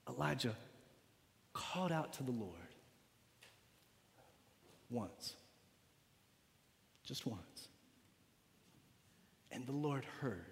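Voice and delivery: very soft and low with long pauses; reverent hush. Real, not acted. Preacher tells congregation of Elijah's one prayer, hushed. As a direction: sink almost to nothing, phrase by phrase.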